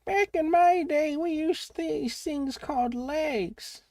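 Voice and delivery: put-on high, slow crotchety old man; quavering old-timer, slow and high. Old-timer mocking train question.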